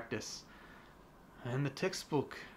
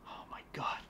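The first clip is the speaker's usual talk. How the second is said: whispered; 9 dB quieter, faster than usual. Real, not acted. Aside under breath.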